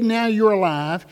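ordinary talk, nothing marked

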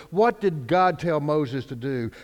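ordinary delivery

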